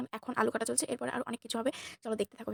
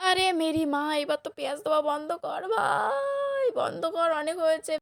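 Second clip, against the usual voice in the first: higher, slow, hammed-up plea to stop; mock exasperated yell; 5 semitones higher, 4 dB louder, much slower than usual. Vlogger begging friend to stop adding onions, mock exasperated yell. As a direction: drag it out like a fake wail.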